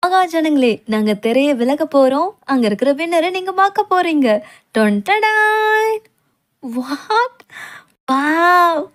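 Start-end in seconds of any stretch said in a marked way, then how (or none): 4.8-6.0 a high, drawn-out slow fanfare sung out; ta-da stretched like a trumpet flourish; fanfare call. Acted. Announcer reveals the festival winner with fanfare.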